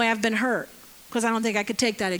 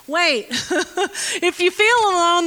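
laughing; 6 semitones higher, 5 dB louder, slower than usual. Real, not acted. Laughing aside to congregation.

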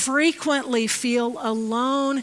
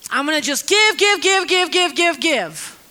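mock eager giving; 4 semitones higher, 6 dB louder than usual. Mocking works-based orphan.

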